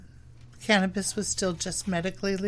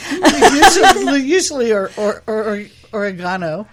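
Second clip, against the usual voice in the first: laughing hard; 3 semitones higher, 15 dB louder than usual. Real, not acted. Grower joking about bad weed with friends, real laughter. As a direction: struggle through the words while laughing. Laughing through her own drug joke.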